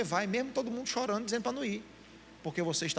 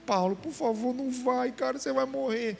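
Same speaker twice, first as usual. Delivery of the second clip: higher, pleading crowd voice; pleading disciples; 6 semitones higher than usual. Retold or imitated. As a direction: beg him not to go. The crowd begging Paulo to stay. (os discípulos implorando a Paulo, voz aguda e suplicante)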